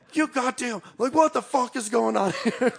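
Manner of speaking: high, exasperated imitation yell breaking into a laugh; mock screaming. Cousin learns they're locked out of the yurt, mock screaming. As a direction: retell his outburst high-pitched, laughing at the end. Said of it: "he just starts screaming at everybody".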